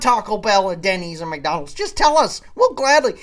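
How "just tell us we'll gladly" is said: "just tell us" shouted much higher and louder; loud exasperated "just tell us"; exasperated plea. Real, not acted. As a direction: beg them at full volume, fed up. Pleading rant peak.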